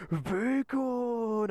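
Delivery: long, drawn-out mock-Korean "bacon"; mimicked Korean accent. Soldiers joking all night about the bacon, mimicked Korean accent. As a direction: parody the Korean man's stretched word. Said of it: "the whole night we're just like".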